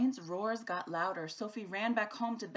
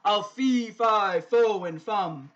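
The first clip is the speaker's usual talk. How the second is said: booming, slow giant roar; 10 dB louder, slower than usual. A narrator's reading. The giant roars at Jack in the old story. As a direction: bellow it like a huge giant. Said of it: "A giant roared".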